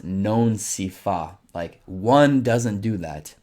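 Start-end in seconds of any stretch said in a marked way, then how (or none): 0.0-1.4 non si fa said slowly, weight on non; Italian pronunciation demo. Shown as a demonstration. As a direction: model non si fa slowly for learners. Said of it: "you don't do that" is".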